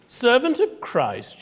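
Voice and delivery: put-on a touch of surprise in the old woman's echo; puzzled echo. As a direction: repeat it back, half puzzled. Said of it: "she responded".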